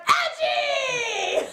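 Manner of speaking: high, delighted shout of his name; excited yell. Retold or imitated. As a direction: cheer as the rival walks back in. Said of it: "and then you're just like".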